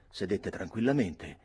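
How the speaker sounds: ordinary delivery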